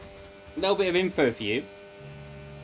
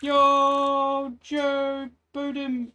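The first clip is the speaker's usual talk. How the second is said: drawn-out call; 10 semitones higher, 3 dB louder, much slower than usual. Real, not acted. Drawn-out name greeting.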